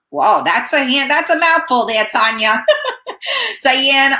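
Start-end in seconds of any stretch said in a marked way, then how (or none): 2.7-3.5 short startled laugh. Real, not acted. Laughing off a harsh chat comment.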